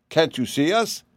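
high little piglet voice; tiny voice. A narrator's reading. Invisible piglet questioning its master, tiny high voice. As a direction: pipe up like a tiny creature. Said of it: "spoke a tiny voice".

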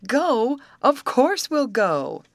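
high, loud and slow; thrilled. A narrator's reading. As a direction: burst with delight. Betty thrilled by the invitation.

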